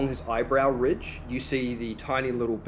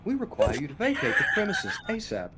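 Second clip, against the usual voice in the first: higher, formal PA announcement; announcer voice; 6 semitones higher than usual. Pretend. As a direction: read it like a loudspeaker warning. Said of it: "It's like the announcer voice too".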